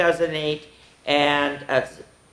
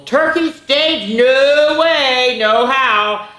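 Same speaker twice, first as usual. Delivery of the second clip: alarmed disbelief; 10 semitones higher, 6 dB louder, much slower than usual. Acted. Turkey balks at being on stage.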